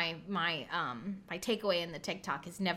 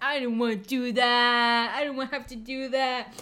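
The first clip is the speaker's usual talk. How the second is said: slow, whining complaint; lightly mocked whining partner; whiny boyfriend; 6 dB louder than usual. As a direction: whine like a sulking kid.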